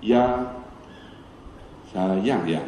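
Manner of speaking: low, soft husband talking to his wife; suara rendah lembut. Retold or imitated. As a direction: answer your wife softly and low, with pauses. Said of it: "rendah suara". (suara suami yang rendah dan lembut)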